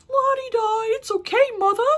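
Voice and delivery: very high, loud, singsong mock forgiveness; hammed-up, high la-di-da voice; mocking forgiving son. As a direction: sarcastically chirp it at full volume. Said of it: "you just want him to be like".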